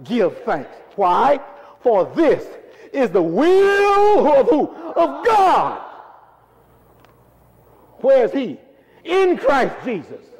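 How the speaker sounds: high-pitched, insistent call-and-response; emphatic preaching. Shown as a demonstration. Preacher demonstrating so they remember the verse, emphatic preaching. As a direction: stretch WILL and GOD high and strong. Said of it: "you say it with emphasis".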